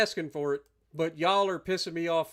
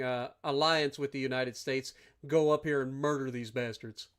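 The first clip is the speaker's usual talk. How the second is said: ordinary talk, nothing marked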